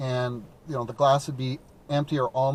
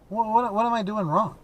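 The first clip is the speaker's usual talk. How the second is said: mock bafflement; 7 semitones higher than usual. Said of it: "mock baffled over pronunciation".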